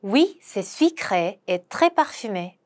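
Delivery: very slow, careful French; slow pronunciation model; slow demo. Shown as a demonstration. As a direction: lay out each syllable slowly for learners. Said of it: "한 번 더".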